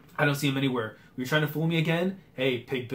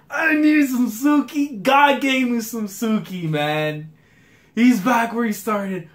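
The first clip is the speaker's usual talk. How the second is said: high, loud and slow; giddy fan gush; 7 semitones higher, 5 dB louder, slower than usual. Real, not acted. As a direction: savor every word with joy. Still hyped over character.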